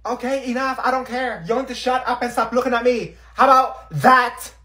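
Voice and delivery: loud, high irritated voice; angry snapping. Acted. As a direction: snap at them to stop. Snapping at staring classmates.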